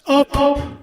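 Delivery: very high, slow anguished accusation; high keening mother demanding an answer; shrieking mother. Acted. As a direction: wail it at your daughter's killer.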